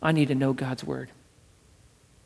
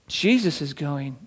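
usual speaking voice

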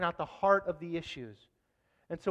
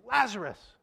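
raised, higher summoning call; 6 semitones higher, 4 dB louder, slower than usual. Retold or imitated. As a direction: call out the name as if summoning someone. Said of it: "he calls out to his name".

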